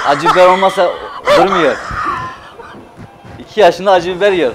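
loud, laughing through the words; proud joke bursting out with laughter. Real, not acted. Father tells the host about his chili-loving toddler, laughing loudly.

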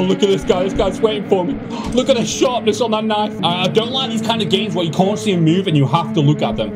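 ordinary talk, nothing marked

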